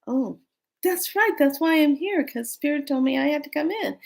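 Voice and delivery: light it up with sudden recall; realising. Retold or imitated. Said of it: "I said to myself".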